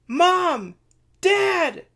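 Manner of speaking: very high, loud joyful calls; cry out. Acted. Children running to their parents, crying out loud. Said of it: "their children cry out".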